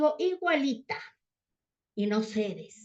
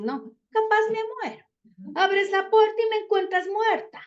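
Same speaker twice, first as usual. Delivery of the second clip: queja dramática; 8 semitones higher than usual. Retold or imitated. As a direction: ham up a self-pitying complaint. (madre dramática, quejándose en voz aguda y alta)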